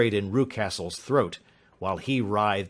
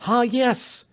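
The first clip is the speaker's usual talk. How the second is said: a sharp, high cry of triumph; cry of discovery; 14 semitones higher, 4 dB louder than usual. A narrator's reading. Detective on the roof spots the ladder, exclaiming. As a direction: call it out as if you've found the clue. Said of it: "he cried".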